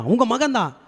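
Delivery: ordinary talk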